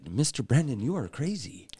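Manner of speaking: lightly voiced German accent; a hint of a German tourist's accent. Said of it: "that's my best accent".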